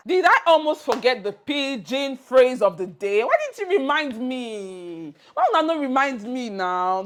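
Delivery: suddenly remembering, a bit too loud; mock surprise. Real, not acted. Teacher catching her own slip, loud surprise. Host realizes she forgot the phrase.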